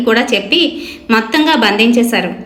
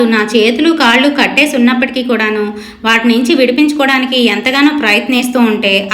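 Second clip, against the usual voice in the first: usual speaking voice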